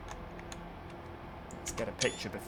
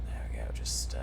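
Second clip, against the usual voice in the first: whisper; 14 semitones lower, 6 dB quieter than usual. Real, not acted. Quiet aside while driving.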